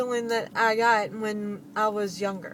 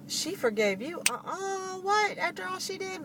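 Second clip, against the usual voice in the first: mock outrage, jumping higher on what; incredulous doubters; 8 semitones higher, 4 dB quieter than usual. Skeptical people scoffing at the forgiveness, mock incredulous. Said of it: "people are probably thinking".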